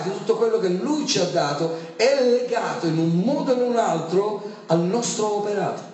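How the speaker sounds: usual speaking voice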